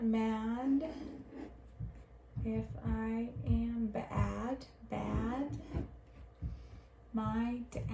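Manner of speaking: slow, soft reading with repeated words. Shown as a demonstration. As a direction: read slowly and quietly while circling. Slow quiet reading while circling.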